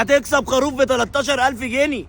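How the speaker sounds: excited. Acted. Hyping the prize value.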